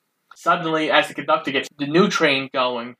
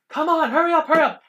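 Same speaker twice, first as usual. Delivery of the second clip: urgent conductor; 13 semitones higher, faster than usual. Retold or imitated. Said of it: "the conductor's like".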